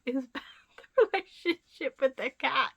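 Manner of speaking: laughing through the words; can't say it for laughing; cracking up. Real, not acted. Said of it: "It's so funny".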